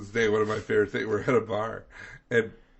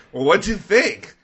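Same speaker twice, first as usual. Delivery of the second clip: loud, raised mock exasperation; retold exasperation; 4 semitones higher, 7 dB louder than usual. Retelling a spat over disappointing soup, mock exasperation. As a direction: act out an indignant exchange, pushed up.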